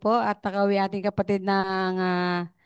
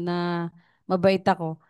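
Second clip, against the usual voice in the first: usual speaking voice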